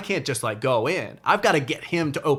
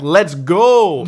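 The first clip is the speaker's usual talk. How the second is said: loud, high shout to drive off; loud urgent shout to the driver; urgent yell; 5 semitones higher, 12 dB louder than usual. Retold or imitated. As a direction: bang on the van and yell. Yelling to van driver.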